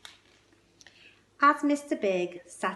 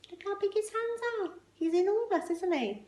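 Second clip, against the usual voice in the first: high, marvelling aside; high-pitched amazed look-at-this; 12 semitones higher than usual. Real, not acted. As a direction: wow them with his size.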